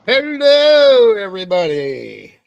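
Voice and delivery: laut und hoch gerufenes Hallo; big greeting. Real, not acted. Opening greeting to the stream.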